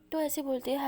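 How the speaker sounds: plain reading voice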